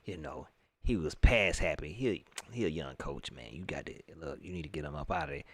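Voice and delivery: lightly voiced conniving reporter egging him on; mocking the reporter. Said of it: "He's like".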